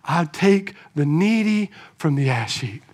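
low, soft recalled words; quiet inner voice. Acted. Preacher voicing what Samuel recalls in his mind, quietly. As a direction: drop low and soft, like a remembered song. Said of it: "in the back of his mind".

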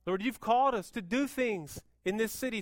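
ordinary delivery

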